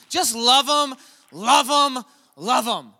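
high, loud, insistent preaching cry; Crystal's insistence. Retold or imitated. Wife texting the pastor to love Zach, high loud insistent cry. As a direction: press it home over and over. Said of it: "Crystal's texting me".

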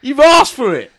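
громкая медленная шуточная угроза; mock threat. Retold threat in shop.

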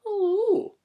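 very high squeaky little voice; doll voice. Acted. Ventriloquist greeting Lynn in a squeaky puppet voice. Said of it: "you knew she was Scottish".

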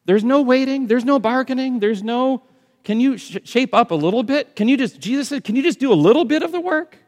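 high, loud, fast preaching; preaching at full force. Acted. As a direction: hammer each line to the crowd.

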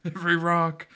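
rally the cruisers, laughing; high loud mock command; mock urgent cops. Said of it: "every cruiser in Boston just like".

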